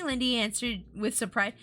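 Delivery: ordinary delivery, nothing marked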